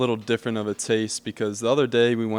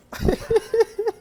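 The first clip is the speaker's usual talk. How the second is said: short high-pitched laugh; 4 dB louder than usual. Real, not acted. Laughing at runny scrambled eggs, high and short. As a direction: let out a quick high laugh.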